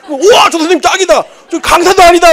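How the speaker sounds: hammed-up fan delight; mock fan squeal. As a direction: gush like an amazed fan. Imagined fans cheering.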